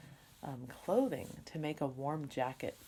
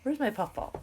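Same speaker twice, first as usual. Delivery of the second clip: playful puppy voice; 10 semitones higher, 6 dB louder than usual. Retold or imitated. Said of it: "He says".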